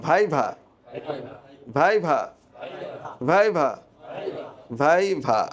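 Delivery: ভাইভা with English V twice, spaced for echo; correct viva drill. Shown as a demonstration. Teacher drilling students without the lips touching, repeated pronunciation model. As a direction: repeat ভাইভা with the lips never closing together. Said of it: "এই, এই ঠোঁট আর লাগবেই না".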